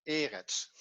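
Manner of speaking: slow eretz, stressed on the opening E; stress on first syllable. Shown as a demonstration. Teacher confirming the student's correction, first-syllable stress on eretz. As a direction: say eretz slowly as E-retz, weight on the first syllable. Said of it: "het is op de eerste".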